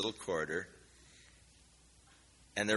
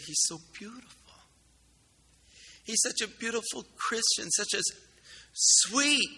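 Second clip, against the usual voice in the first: higher, lightly voiced distraught girl; tearful girl; 10 semitones higher, 3 dB louder than usual. Girl sobbing over her sick pastor, higher and tearful. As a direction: echo her grief, a touch tearful. Retelling the sobbing girl's words.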